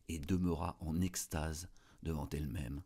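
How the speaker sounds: plain reading voice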